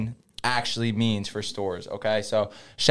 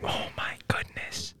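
slow airy whisper; 10 dB quieter, slower than usual. Real, not acted. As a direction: draw it out in a whisper. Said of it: "we're whispering into the mics".

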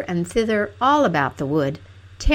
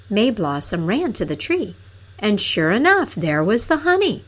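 plain reading voice

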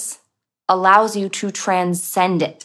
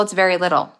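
normal talking voice

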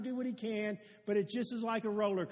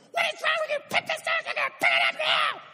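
retold furious yell, much higher and louder; berserk yelling; 9 dB louder, faster than usual. Pastor imitating angry friend yelling at his kid, full shout. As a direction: bellow at the kid like a father losing it. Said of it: "he immediately looked like berserk".

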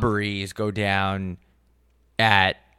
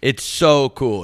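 ordinary delivery

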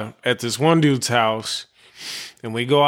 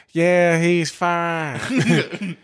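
very slow Chris; 4 semitones higher, much slower than usual. Retold or imitated. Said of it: "He's like".

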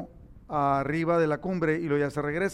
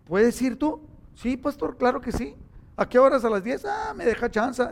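lightly voiced friendly phone chat, a bit higher; retold phone chat; 4 semitones higher than usual. Preacher retelling the pastor's call, lightly voiced, higher. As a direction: replay the late call brightly.

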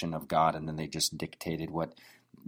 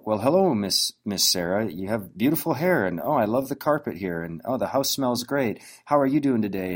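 higher-pitched, over-hyped compliments; gushing flattery; 3 semitones higher, 3 dB louder than usual. Acted. Salesman fawning over a customer at home, gushing and hyped-up. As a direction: lay it on thick, like an overexcited dork. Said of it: "super hypey and excited about the person".

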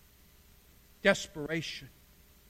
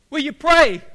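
louder, higher pressing request; insistent plea; 6 semitones higher, 12 dB louder than usual. Acted. Dying man begging the chaplain for prayer, loud and insistent. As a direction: press the request hard, raising pitch and volume.

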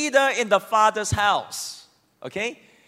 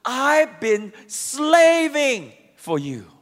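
slow, bitter and louder; resentful; 3 semitones higher, 4 dB louder, much slower than usual. Acted. Son resenting his father, slow and louder. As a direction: spit out the complaint slowly. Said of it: "no love whatsoever in his tone".

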